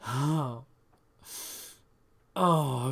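sharp, loud high gasping cries. Acted. Lover gasping loudly mid-scene, sharp cries. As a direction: let out sudden loud gasps.

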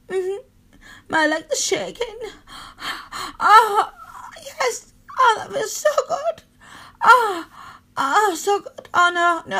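high, loud cries of pleasure; pitched high, voice near crying; ecstatic moaning. Acted. Climax during the intimate scene.

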